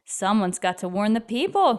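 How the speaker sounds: usual speaking voice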